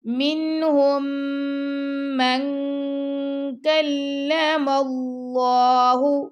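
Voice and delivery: من with the noon hidden, nasal, slow. Shown as a demonstration. Teacher demonstrating ikhfa. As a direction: hide the noon of من into کلم. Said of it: "ہم اخفا بھی کریں گے".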